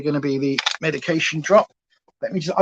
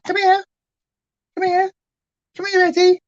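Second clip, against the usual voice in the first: high dog-calling voice; 12 semitones higher than usual. Real, not acted.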